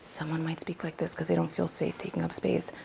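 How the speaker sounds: very soft, barely-there voice; hushed and unobtrusive; timid low volume. Shown as a demonstration. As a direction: speak tiny, as if afraid to take up space. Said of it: "The volume at which we speak".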